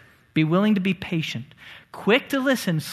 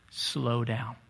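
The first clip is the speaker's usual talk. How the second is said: dropped low and soft, much softer; soft key words; 7 semitones lower, 9 dB quieter than usual. Shown as a demonstration. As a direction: let it fall softly, like calming someone. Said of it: "the key word".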